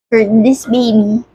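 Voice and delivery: ordinary talk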